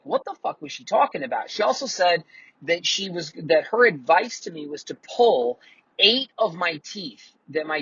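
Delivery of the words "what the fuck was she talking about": incredulous outburst, pitched higher; retold disbelief. Retelling his panic to the dentist, pitched high and incredulous. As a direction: mimic his own shock at the dentist. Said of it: "And I was like".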